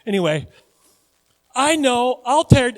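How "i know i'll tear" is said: high, loud mock eureka; not-so-brilliant rich fool. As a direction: play a fool struck by a bad idea. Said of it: "this guy's not really that brilliant".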